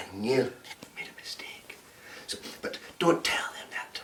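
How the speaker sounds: unvoiced secret whisper to the audience; whispered aside. Shown as a demonstration. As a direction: whisper it so the customer can't hear.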